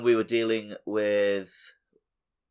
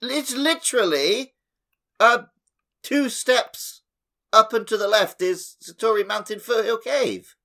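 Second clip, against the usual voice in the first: excited insistence; 9 semitones higher, 5 dB louder than usual. Real, not acted. Insisting back at co-host.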